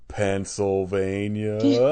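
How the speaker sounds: "Pennsylvania" stretched very slowly; drawn-out punchline. Retold or imitated. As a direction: draw every syllable of "Pennsylvania" out theatrically. Quotes a punchline, drawing it out.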